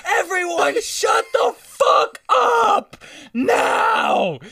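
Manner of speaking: high, strained yell, drawn out. Retold or imitated. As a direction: re-enact a fed-up outburst. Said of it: "the second Dylan raised his voice".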